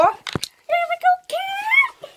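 singsong. Pretend. Starting hide and seek count.

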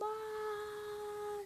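high, held "aah"; sustained high cry; long mock cry. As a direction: draw out a high open wail. Mock cry while watching fight.

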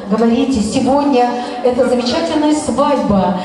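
ordinary talk

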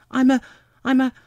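high, loud, halting attempts; faltering child's voice; flustered little girl. A narrator's reading. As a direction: start and break off, at a loss. Alice falters over her answer.

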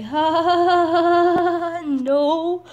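high, loud forced laugh that drags out the no; a mocking ha ha ha brushing him off; fake laugh. Laughing off a guy who asks if she's single.

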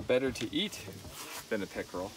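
usual speaking voice

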